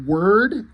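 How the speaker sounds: "word" lifted into a question; questioning tune. Retold or imitated. Dog puzzled by decommissioned, "word" as a rising question. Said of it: "question mark".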